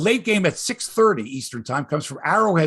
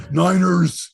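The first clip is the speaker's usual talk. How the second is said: louder, hammed-up "Niners" in a Robin Williams voice; a lightly done Robin Williams "Niners"; Robin Williams impression; 2 semitones higher, 6 dB louder than usual. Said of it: "It's more Robin Williams".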